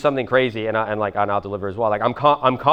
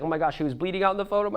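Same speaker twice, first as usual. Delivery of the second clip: high, softer mock panic; freaking-out Cuban grandmother; 8 semitones higher, 6 dB quieter, faster than usual. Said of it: "Cuban grandmother just freaking out".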